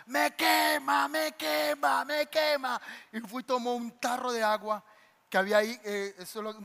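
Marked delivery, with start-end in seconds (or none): 0.1-2.8 higher, slow mock cry of burning; lightly voiced demoniac crying out; imitating the possessed screaming. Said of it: "él empezó a gritar". (imitación del poseído gritando que le quema)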